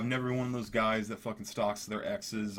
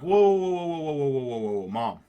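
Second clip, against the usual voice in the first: loud, high whoa whoa; frantic shout to stop her; alarmed shout; 4 semitones higher, 9 dB louder than usual. Retold or imitated. Panicked son stopping mom calling police.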